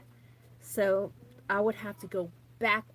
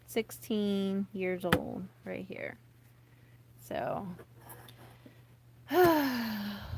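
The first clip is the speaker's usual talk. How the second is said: ordinary talk, nothing marked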